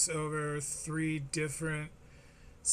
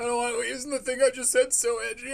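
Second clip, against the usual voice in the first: higher put-on voice, hammed-up regret; mocking imitation; 6 semitones higher, 4 dB louder than usual. Host parodies comedian regretting his edgy joke, mocking imitation. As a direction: play a self-consciously edgy performer.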